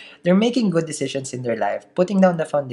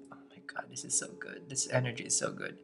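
breathless whisper, far softer; excited whisper; 3 semitones lower, 18 dB quieter than usual. Real, not acted. Reader whispering to viewers over a great card spread. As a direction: hush it like a secret thrill. Thrilled by the cards.

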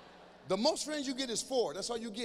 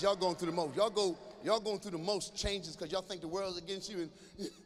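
ordinary talk

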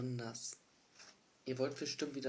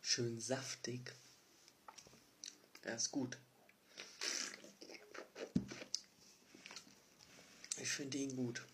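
chewing noisily while talking; smacking lips. Real, not acted. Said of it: "Hör auf zu schmatzen".

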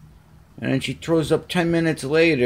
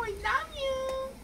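very high squeaky "I love you", softer; high cat voice; 11 dB quieter than usual. Shown as a demonstration. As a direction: coax him with a tiny high voice. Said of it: "modelling for cat".

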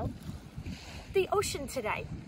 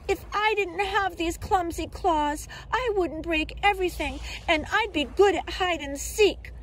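higher-pitched little crab voice; a small, put-upon crab lamenting in a raised voice; Nipper's voice; 6 semitones higher than usual. A narrator's reading. Clumsy crab to his friends, high-voiced complaint. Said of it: "said Nipper".

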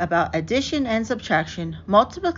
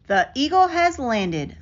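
announcing landing; 6 semitones higher than usual. A narrator's reading. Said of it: "announced astronaut Neil Armstrong".

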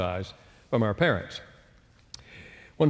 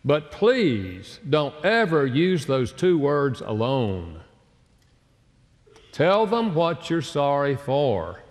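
slow emphatic; 2 semitones higher, much slower than usual. Acted. Preacher stressing advice slowly.

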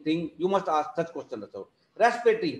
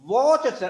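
"watch" punched higher and louder; stress on watch; 6 semitones higher, 5 dB louder than usual. Shown as a demonstration. Teacher stressing a key word to students, emphatic. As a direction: hit the word "watch" hard, then cut off. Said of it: "I'm emphasizing the word".

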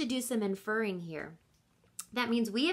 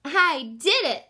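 proud exclaim; 5 semitones higher, 9 dB louder than usual. Acted. Jabari proud.

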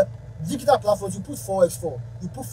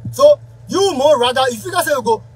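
loud, higher, heated rant; 3 semitones higher, 10 dB louder than usual. Real, not acted. Musician venting loudly at greedy colleagues, heated. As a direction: raise the voice and vent hard. Ranting about musicians not paying.

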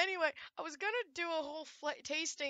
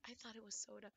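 whispering; 4 semitones lower, 15 dB quieter, faster than usual. Real, not acted. Disappointed whisper.

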